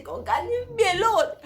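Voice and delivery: laughing yell. Retold or imitated. In labor, laughing yell at the husband about her clothes. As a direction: snap back at him, half laughing.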